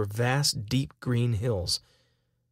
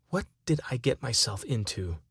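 plain reading voice

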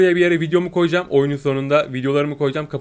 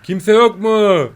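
slow, higher call into the street; 6 semitones higher, 4 dB louder, much slower than usual. Real, not acted. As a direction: call out slowly to anyone near. Calling for the owner.

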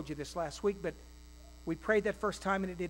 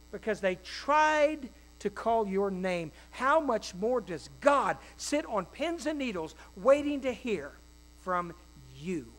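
ordinary talk, nothing marked

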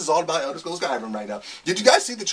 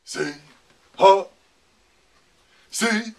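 loud high roaring dragon chant; 9 semitones higher, 7 dB louder, much slower than usual. Pretend. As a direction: belt it out like a dragon. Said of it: "I just used my dragon voice".

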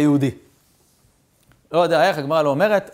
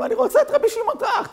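much higher, shrieking demand; possessed girl screaming. Acted. Possessed princess screams for Rabbi Shimon, shrieking high.

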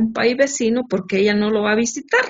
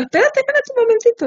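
higher, fast, feigned alarm; mock urgent; faster than usual. Wife interrupting husband on the phone, fast and higher. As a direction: stop him short in mock panic.

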